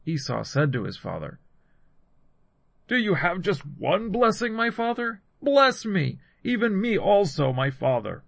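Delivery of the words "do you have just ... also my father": high pleading, falling away at the end; tearful plea. A narrator's reading. Esau begging his father for one blessing, understated tearful plea. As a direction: plead with raised voice, without sobbing. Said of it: "Esau lifted up his voice and wept".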